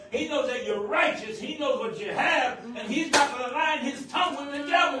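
fiery sermon climax, loud and slowing; full-force preaching. Acted. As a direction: preach it home, building to a shout.

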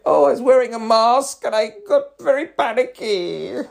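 mock a fussy, self-pitying moan; hammed-up whiny snowflake voice; demeaning voice. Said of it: "that demeaning voice".

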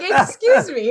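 loud, high outraged quote; indignant excuse me. Retold or imitated. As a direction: act offended that he went to bed. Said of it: "I'm like".

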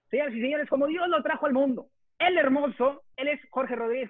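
high, grand ringmaster introduction. Acted. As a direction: present him like the star act. Host grandly introduces his co-host.

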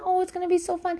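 gushing high cheer. Retold or imitated. Repeated gushing.

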